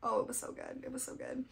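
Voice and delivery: soft, half-whispered, choked up; real teary hush over a beloved book; quietly moved, voice barely there; near tears. Real, not acted. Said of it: "I'm gonna cry again".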